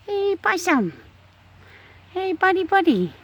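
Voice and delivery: high cooing at her chicken; high pet talk. Real, not acted. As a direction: coo the name up high.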